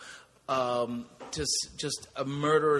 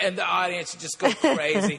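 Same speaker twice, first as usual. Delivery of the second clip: normal talking voice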